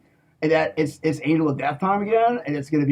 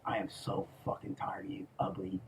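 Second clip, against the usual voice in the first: hissed whisper, much softer; menacing whisper; 8 semitones lower, 17 dB quieter than usual. Real, not acted. Whispering his rage at the people he hates. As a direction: breathe the threat out through the teeth. Threatening rant at enemies.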